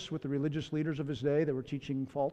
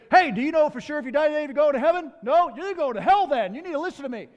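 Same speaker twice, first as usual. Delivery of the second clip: higher, louder pushy challenge; aggressive preaching; 9 semitones higher, 5 dB louder than usual. Retold or imitated. Howie confronting a stranger, loud and pushy. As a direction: corner a stranger about heaven and hell. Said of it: "this is the way he talked to people".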